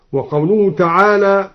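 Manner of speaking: usual speaking voice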